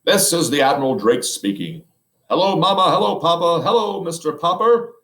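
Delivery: an explorer broadcasting from the South Pole; Admiral Drake on the radio. Acted. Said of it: "a faint voice floated out".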